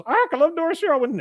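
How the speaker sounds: very high parrot squawk; shrieky, high mock parrot voice; mynah bird imitation. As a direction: squawk the phrase like a mimicking bird. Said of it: "she just goes".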